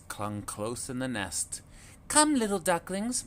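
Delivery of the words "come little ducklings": high, louder call to the ducklings; sing-song calling mother duck. A narrator's reading. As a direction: call them over, mama duck. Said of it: "Mummy called again".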